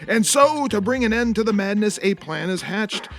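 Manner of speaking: deep, dramatic trailer narration; movie trailer voice. Acted. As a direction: sell it like an epic film trailer. Movie trailer intro parody.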